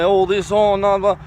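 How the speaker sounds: ordinary talk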